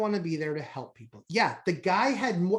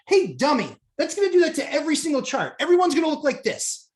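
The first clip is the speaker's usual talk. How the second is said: raised, exasperated scolding; retold apoplexy; 7 semitones higher, 4 dB louder, faster than usual. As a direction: scold the screen in mock outrage. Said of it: "I'm absolutely apoplectic".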